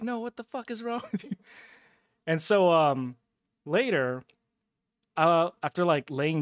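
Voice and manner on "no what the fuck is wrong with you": high-pitched imitation of an outraged woman; hammed-up high female protest; mock indignant woman. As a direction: pitch it up for her scolding, a laugh breaking in. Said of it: "and she's like".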